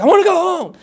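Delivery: loud, high mock whine; desperate plea. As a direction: beg the cops to let you go. Begging police, retold.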